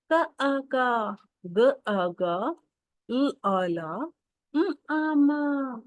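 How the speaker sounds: very slow, drawn-out syllables to repeat; slow read-along. Shown as a demonstration. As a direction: stretch each sound so kids can follow. Teacher continues read-along drill. (बहुत धीमे, खींचकर साथ पढ़वाना)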